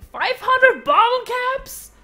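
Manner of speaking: high, loud, slow sly ask; high drawn-out greedy ask; cheeky demand. Acted. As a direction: squeeze them for caps. NPC demanding big price.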